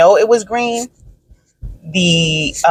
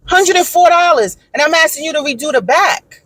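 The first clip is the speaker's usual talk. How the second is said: high-pitched, indignant retold argument; fed-up customer telling them off; heated complaint; 8 semitones higher than usual. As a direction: argue it emphatically, voice pushed high. Retold heated complaint to lawn company.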